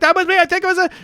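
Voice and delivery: very high, loud eager claim; excited claim. Retold or imitated. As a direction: insist excitedly.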